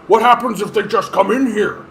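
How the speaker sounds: imitating a scared Nixon. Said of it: "We literally have him saying to people".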